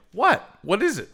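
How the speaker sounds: high, loud questioning; mock startled. Father halted by his little girl's protest, mock startled. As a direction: act out being stopped short.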